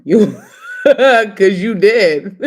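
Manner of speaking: loud laughter breaking the words; loud real laughter over the words; laughing hard. Real, not acted. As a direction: crack up at the joke. Bursting into laughter.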